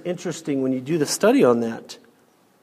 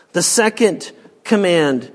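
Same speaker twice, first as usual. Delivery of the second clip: usual speaking voice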